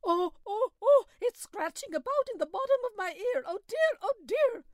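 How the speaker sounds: high, whining moan of pain; high complaining groans. A narrator's reading. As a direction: moan like something is scratching inside your ear. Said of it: "began to moan again".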